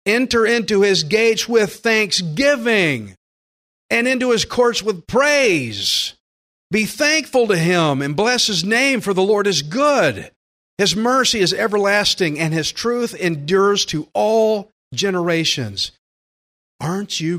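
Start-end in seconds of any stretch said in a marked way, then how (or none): 0.0-6.1 higher, louder, slow and jubilant; joyful scripture reading. Shown as a demonstration. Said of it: "No, we're, we should be past that". 6.7-15.9 scripture reading. Acted. Reads the psalm aloud.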